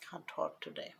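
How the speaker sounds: usual speaking voice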